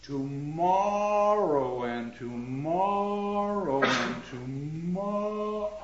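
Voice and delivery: drawn out, slow verse reading; slow, weighted Shakespeare reading; slow recitation. Shown as a demonstration. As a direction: let each tomorrow stretch long. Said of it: "It is Shakespeare at his greatest".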